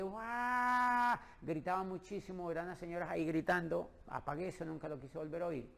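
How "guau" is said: grito de guau más fuerte y alto; grito imitado. Retold or imitated. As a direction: imita a las señoras gritando en el audio. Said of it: "eso gritaban horrible". (las señoras del audio gritan guau, imitado)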